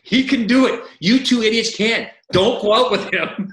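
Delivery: loud, high, slow retold bawling-out; steaming coach. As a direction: imitate a furious coach laying down orders. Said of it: "he's just steaming".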